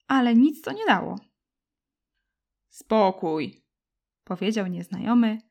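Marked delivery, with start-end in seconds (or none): 2.7-3.6 lower, firm command; a lightly voiced giant silencing the children. Bearded giant silences the fighting children, low and firm. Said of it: "siła jego głosu".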